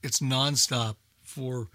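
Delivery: ordinary talk, nothing marked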